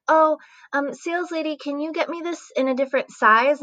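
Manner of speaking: put-on high-pitched voice of a girl asking a favor; acted polite request. Voicing customer asking saleslady.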